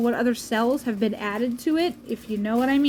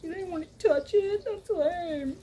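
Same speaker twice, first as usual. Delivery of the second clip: higher, whiny mock commenter; 5 semitones higher, 5 dB quieter, slower than usual. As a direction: sneer it like an online troll. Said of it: "makes fun of me in the comments".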